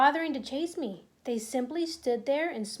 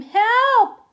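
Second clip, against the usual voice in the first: very high, loud desperate scream; scream for help; 10 semitones higher, 12 dB louder than usual. Acted. Boy calling to the doors, desperate scream. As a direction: scream so someone outside hears. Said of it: "I screamed".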